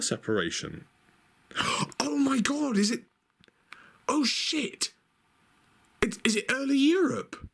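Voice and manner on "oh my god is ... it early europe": excited discovery. Real, not acted. Spots a map on the cover.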